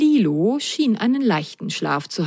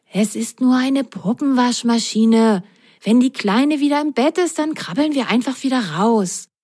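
higher-pitched little sock voice, mildly soothing; reassuring; 5 semitones higher than usual. A narrator's reading. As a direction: reassure a friend in a high character voice. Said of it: "Ron beruhigte sie".

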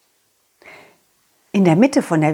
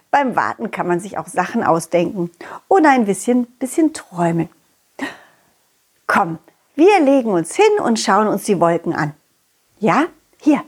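plain reading voice

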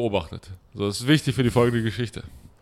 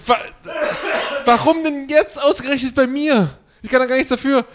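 exasperated protest; 12 semitones higher, 4 dB louder than usual. Retold or imitated. Customer protesting the cashier leaving, high laughing outcry. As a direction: wail it in mock outrage. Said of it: "Und ich so".

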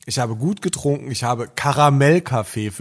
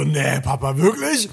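imitating an incredulous child, leaping up at the end; mock disbelief, pitch jumping on the last word; amazed son; slower than usual. Son questions his dentist father about the video, amazed.

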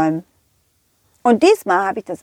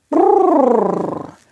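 rolled-lip brrr, pitched up; disgusted shudder; 5 semitones higher than usual. Acted. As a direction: shake it off with a long trilled shudder. Shudders at the stinky shoe.

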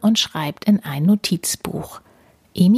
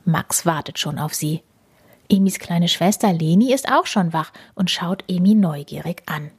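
ordinary delivery

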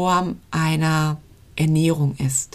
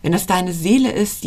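ordinary talk, nothing marked

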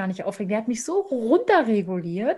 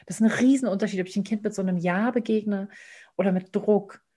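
usual speaking voice

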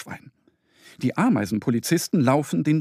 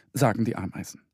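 plain reading voice

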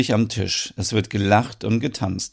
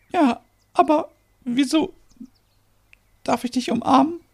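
very high, halting voice; overwhelmed disbelief. Acted. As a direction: ask haltingly, overcome by surprise. Merit overcome at unexpected arrival.

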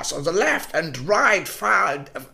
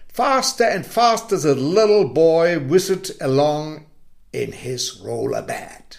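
plain reading voice

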